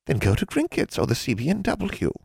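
put-on an old witch toying with a girl; sly old hag. A narrator's reading. Hag bargaining with a young witch, lightly sly.

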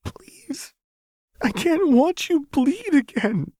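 high, slow sobbing plea. Acted. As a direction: beg through tears. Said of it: "Lux sobbed".

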